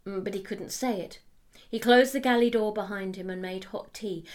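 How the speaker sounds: ordinary delivery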